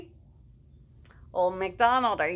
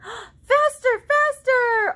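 high, loud cry of faster; excited shout; 10 dB louder, much slower than usual. Acted. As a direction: cheer them on, loud and high. Reading farm book excitedly to kids.

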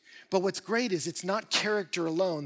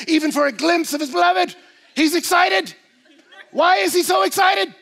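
much higher and louder, near a shout; full-force preaching; 7 semitones higher, 12 dB louder than usual. Acted. Pastor to congregation about the eager groom, shouting. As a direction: preach it out with rising fire.

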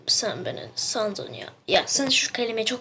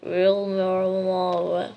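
put-on American accent; 2 semitones lower, slower than usual. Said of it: "Amerikan aksanıyla konuşuyorsun". (Amerikan aksanıyla ağır konuşma)

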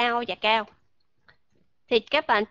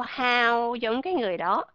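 ordinary delivery

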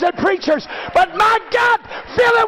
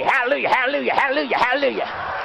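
imitating Charlie Mahaney; 5 semitones lower, 4 dB quieter, much faster than usual. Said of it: "Sound like Charlie Mahaney".

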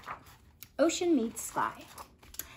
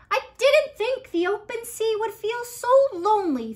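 Finn's voice; 10 semitones higher, 8 dB louder than usual. Acted. Finn speaking.

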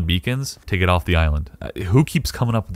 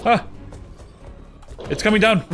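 scared horror game streamer; 14 semitones higher than usual. Real, not acted. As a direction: panic at the monster. Monster attacking.

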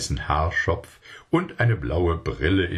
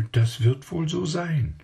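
put-on child voice; 10 semitones higher than usual. A narrator's reading. Child voice answering merchant.

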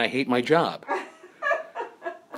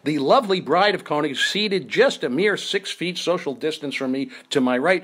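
show host intro; 5 semitones higher, 3 dB louder than usual. Acted.

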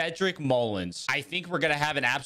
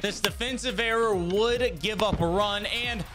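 higher, even voiceover read; video recap narration; 7 semitones higher than usual. Acted. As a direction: narrate the recap like a highlight reel.

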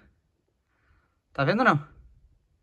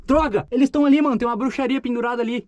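excited; 6 semitones higher than usual. Real, not acted. Spots the witchcraft hanging.

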